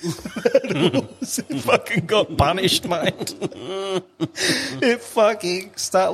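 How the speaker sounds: slow, high talk through laughter; laughing. Real, not acted. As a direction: laugh while admitting the loss. Laughing at the lost bet.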